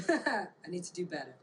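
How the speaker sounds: ordinary talk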